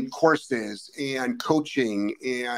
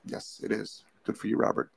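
whispered aside; 5 semitones lower, 9 dB quieter than usual. Real, not acted. Aside while reading comments.

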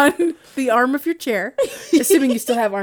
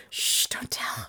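whisper; 11 dB quieter, slower than usual. Real, not acted. Hushing a secret.

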